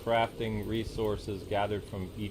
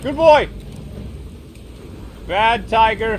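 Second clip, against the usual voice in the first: high, loud scolding yell; scolding shout; 9 semitones higher, 9 dB louder than usual. Real, not acted. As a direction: shout at the tiger. Scolding attacking tiger.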